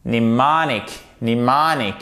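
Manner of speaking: "Mnemonic" as "ni-MAH-nik"; stress on second syllable. Shown as a demonstration. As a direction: lean on the MON of "Mnemonic", M silent. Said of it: "de l'accent tonique".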